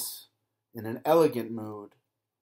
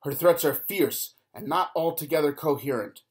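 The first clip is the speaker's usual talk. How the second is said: plain reading voice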